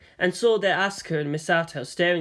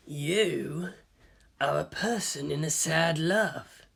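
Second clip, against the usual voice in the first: softer, slow murmur; low rumbling tone; 6 dB quieter, slower than usual. A narrator's reading. Kaji murmurs to Ritsuko, softer and slow. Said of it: "said Kaji in a low, rumbling tone".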